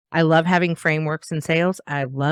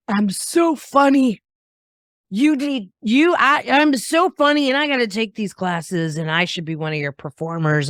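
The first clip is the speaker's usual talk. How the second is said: cocky wannabe; 5 semitones higher than usual. Retold or imitated. Wannabe student boasting to the improv teacher, a hint of mock cockiness. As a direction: ham up a self-satisfied newcomer. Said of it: "The people that would show up and go".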